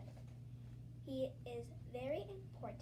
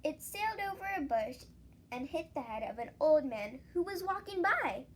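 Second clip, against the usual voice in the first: usual speaking voice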